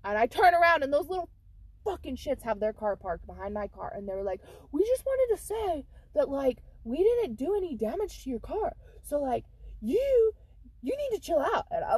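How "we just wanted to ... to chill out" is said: mocking teen voice. Teen boys mock her, voiced.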